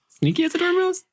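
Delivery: joking pitch jump on the saying; squeaky guess. Real, not acted. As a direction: squeak it as a silly question.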